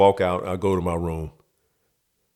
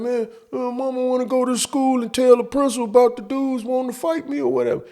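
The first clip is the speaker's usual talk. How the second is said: high whiny complaint. Retold or imitated. Kid complaining to stepdad.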